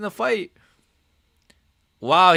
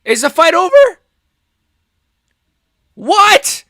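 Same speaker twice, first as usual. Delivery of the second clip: shouted disbelief, much higher and louder; stunned shouting; 11 semitones higher, 10 dB louder than usual. Real, not acted. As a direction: yell in shock at the screen. Fight restarts unexpectedly, streamer stunned.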